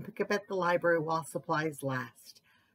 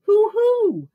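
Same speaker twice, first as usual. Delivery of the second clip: high, loud hoot; owl hoot; 13 semitones higher, 10 dB louder than usual. A narrator's reading. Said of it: "We growl, squeak, and hoo-hoo".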